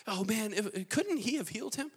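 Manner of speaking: high-pitched complaint; plaintive wondering. Acted. Mourners wishing Jesus had healed Lazarus, high and plaintive. Onlookers' lament acted out.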